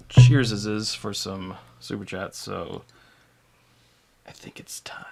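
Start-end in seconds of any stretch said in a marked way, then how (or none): 4.3-5.1 whispered, barely voiced; hushed announcement. Real, not acted. Host to co-host before the super chat toast, whispered. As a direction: say it under your breath, in a whisper.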